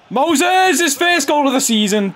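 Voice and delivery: high, loud yell of the scorer's name; thrilled commentator shout; excited goal shout. Real, not acted. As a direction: cheer the goal at full pitch.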